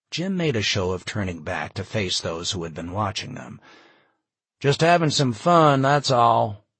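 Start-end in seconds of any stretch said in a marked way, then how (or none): 4.6-6.7 louder, higher, for all to hear; announcing. A narrator's reading. Jim reassures those watching he was joking, announcing loudly. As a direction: call it out to onlookers. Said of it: "Jim announced".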